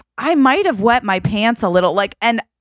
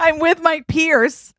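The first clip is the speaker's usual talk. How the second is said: slow words through laughter; laughing; 8 semitones higher, slower than usual. Real, not acted. Telling the podcast host about the scare, slow through laughter. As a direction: laugh it out as you talk.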